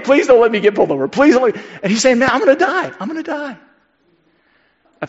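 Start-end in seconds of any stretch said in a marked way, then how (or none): 0.0-3.6 fast, high frantic pleading; mock panicked teen pleading; panicked prayer. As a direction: beg like a scared kid driving. Panicked teen praying voiced.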